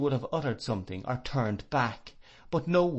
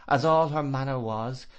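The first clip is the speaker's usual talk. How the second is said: ordinary delivery, nothing marked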